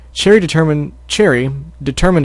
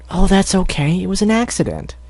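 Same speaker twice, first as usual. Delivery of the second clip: gentle filly; 6 semitones higher than usual. Acted. Cherry reassuring Scootaloo, high gentle voice. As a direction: reassure your friend as a little filly.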